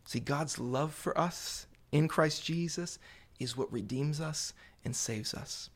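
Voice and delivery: usual speaking voice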